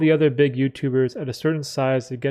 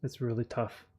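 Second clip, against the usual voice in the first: emotional, fading quiet; 8 dB quieter than usual. Real, not acted. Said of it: "went on that long emotional rant".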